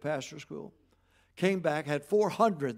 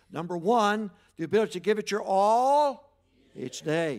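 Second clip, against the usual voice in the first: call and response; 4 semitones higher, 8 dB louder than usual. Real, not acted. Congregation recites the points.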